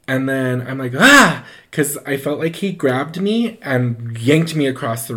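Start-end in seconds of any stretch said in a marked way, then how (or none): 1.0-1.4 high, loud short shriek; re-enacted cry of fright; startled scream. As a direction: scream as if suddenly grabbed. Acts being suddenly grabbed.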